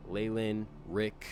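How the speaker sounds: ordinary talk, nothing marked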